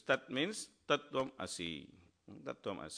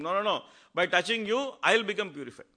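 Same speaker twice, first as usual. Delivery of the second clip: raised, louder eager reassurance; eager insistence; 7 semitones higher, 7 dB louder than usual. Retold or imitated. Mahaprabhu insisting to Haridas, raised eager reassurance. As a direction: insist warmly on embracing him.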